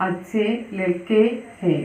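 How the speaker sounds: slow, careful Hindi read; teacher dictating the plural sentence slowly; slow model sentence. Shown as a demonstration. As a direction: dictate it word by word. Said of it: "ഈ സെന്റൻസുകളുടെ പ്രത്യേകത നോക്കിയേ".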